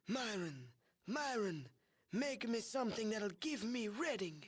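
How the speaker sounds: put-on high, frail wheezing old voice; a hint of a wheezy old boss; wheezing old man. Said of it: "in his wheezing voice".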